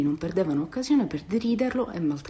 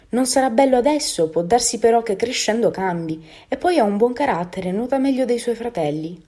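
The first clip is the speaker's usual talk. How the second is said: lightly voiced mother duck, louder and higher; a touch of insistent defence; reassuring mother duck; 5 semitones higher, 6 dB louder than usual. A narrator's reading. Mother duck defending her duckling's looks, insistently reassuring. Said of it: "assicurò mamma anatra".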